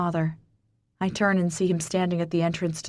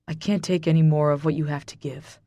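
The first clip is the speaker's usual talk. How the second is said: ordinary delivery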